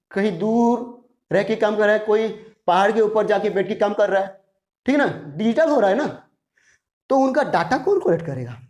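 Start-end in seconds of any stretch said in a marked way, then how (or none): none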